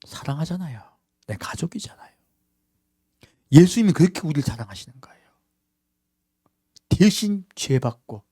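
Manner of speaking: hushed, intimate whisper; whispered tenderness; tender whisper. Real, not acted. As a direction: whisper it as a secret of love. Tender climax of sermon.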